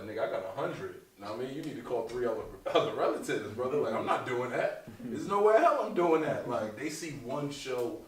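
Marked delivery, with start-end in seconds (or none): 0.0-6.5 rising exasperation, higher and louder toward the end; exasperated reply. Retold or imitated. Comedian refusing his uncle's four hundred dollar ask, building to outraged. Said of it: "I was like".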